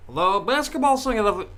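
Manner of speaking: put-on high, loud old-time radio announcer; transatlantic radio announcer. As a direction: boom it out like a 1930s newsreel. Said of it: "transatlantic radio announcer voice".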